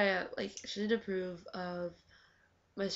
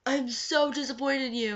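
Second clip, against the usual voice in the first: higher, louder prim disappointment; mock scolding; 6 semitones higher, 6 dB louder than usual. Sue scolding the niece for smoking, mock prim disapproval. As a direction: play the disapproving family friend.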